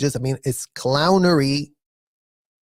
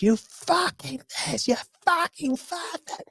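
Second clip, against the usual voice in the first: high, mocking imitation of a cursing woman; mock swearing lady; 13 semitones higher, 5 dB quieter than usual. Mocking the swearing ladies in a high, sneering voice.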